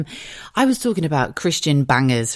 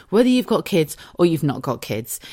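ordinary talk

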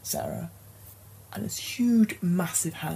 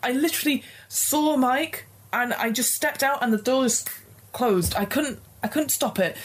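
high distressed voice; 7 semitones higher than usual. Acted. Sarah voiced, upset.

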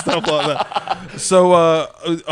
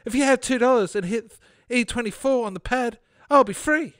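little fat man; 7 semitones higher than usual. Acted. Trapped little man asks Fred for money, high voice. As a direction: ask urgently in a high character voice. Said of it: "the little fat man said".

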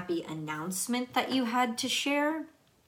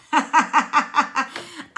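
high, loud rapid cackle; burst of laughter; 6 semitones higher, 7 dB louder than usual. Real, not acted. Reader amused at the viewer's discomfort, loud high laughter. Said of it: "I'm, I'm laughing".

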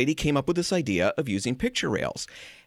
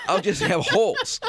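laughing. Real, not acted. Laughing at own joke.

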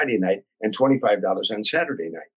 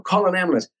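higher retold call for help; retold yelling; 7 semitones higher than usual. Narrator yells for an ambulance after Jay falls, retold yell. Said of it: "I'm yelling".

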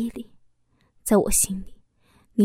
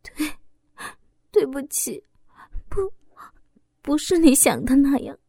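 hesitant, stammering apology; timid stammer; 4 semitones higher than usual. A narrator's reading. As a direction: falter out the excuse in fear. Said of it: "我怯怯地说道". (怯怯地结巴着辩解)

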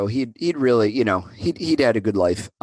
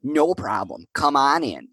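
put-on Buffalo accent; 6 semitones higher than usual. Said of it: "my worst Buffalo accent".

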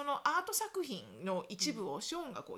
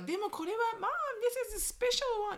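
put-on pleading child; 11 semitones higher than usual.

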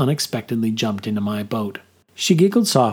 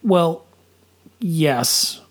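sheepish; 6 semitones higher than usual. A narrator's reading. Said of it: "I admitted sheepishly".

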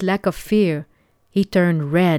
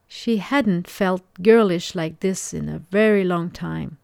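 ordinary delivery, nothing marked

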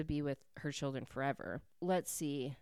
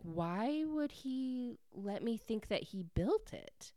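bewildered question; 6 semitones higher, 4 dB quieter, slower than usual. Retold or imitated. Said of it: "Grace is so confused".

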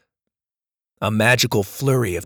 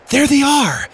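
excited call; 14 semitones higher, 5 dB louder than usual. Acted. Susie spots pirates.

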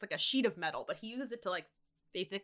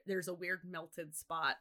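ordinary talk, nothing marked

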